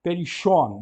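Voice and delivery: "Perichon" with the double sigma as SH, pe-RI-shon; Ionic pronunciation. Shown as a demonstration. Teacher models the Ionic reading for students, clear. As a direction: read "Perichon" with a SH sound in the middle. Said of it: "Nós optamos por ler como sh".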